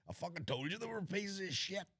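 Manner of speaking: high, ranting put-on New York voice; imitating a street rant; mock angry New Yorker. Mocking New Yorker clip.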